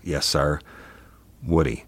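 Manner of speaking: usual speaking voice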